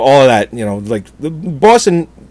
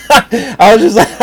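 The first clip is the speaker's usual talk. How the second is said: real laugh spilling through the words, slowed down; can't get the words out for laughing; laughing out loud; 10 semitones higher, slower than usual. Real, not acted. Retelling the Dark Shadows tour, cracking up. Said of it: "and I laughed out loud".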